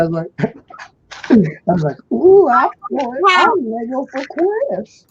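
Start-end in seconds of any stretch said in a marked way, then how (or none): 2.1-5.1 put-on high, loud babyish squeal. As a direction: coo it like a toddler. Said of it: "saying his old channel name with a baby voice".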